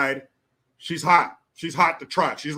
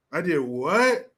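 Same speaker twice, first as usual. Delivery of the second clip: mock disbelief; 2 semitones lower, slower than usual. Voicing shocked morning-after drinker.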